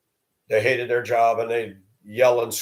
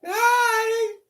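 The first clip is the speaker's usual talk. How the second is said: very high, chirpy greeting; high cutesy hello of a coworker who comes to chat; mock sing-song hi. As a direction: squeak out a fake-friendly hi. Mocking a chatty coworker's greeting.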